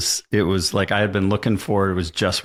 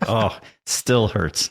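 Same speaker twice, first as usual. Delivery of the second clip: normal talking voice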